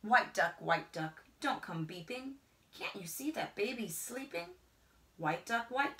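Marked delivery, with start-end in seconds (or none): none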